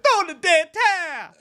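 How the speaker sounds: high mock corner-man yell in a put-on voice; hammed-up boxing trainer shout. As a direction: shout it from ringside. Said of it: "had the trainer from Rock and be like".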